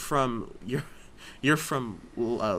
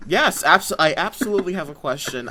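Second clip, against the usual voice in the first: loud, higher, emphatic yes; put-on confidence; 4 semitones higher, 6 dB louder than usual. Told by the host to sound surer, mock confident. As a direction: overdo the confidence on request. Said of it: "say that with more confidence".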